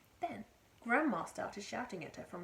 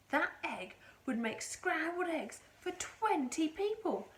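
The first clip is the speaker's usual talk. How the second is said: a touch higher, slower mother's voice; Missus Cranky; 7 semitones higher, 4 dB louder, slower than usual. A narrator's reading. Mother marvelling at the giant egg, lightly voiced. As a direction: marvel at the size. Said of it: "Missus Cranky said".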